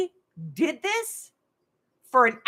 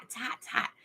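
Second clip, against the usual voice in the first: whispering it's hot under her breath; a hushed mock wince at the heat; whispered complaint; 11 dB quieter than usual. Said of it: "Sandy's like".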